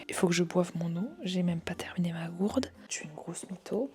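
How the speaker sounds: soft unvoiced whisper. Real, not acted. Kids asleep, whispering.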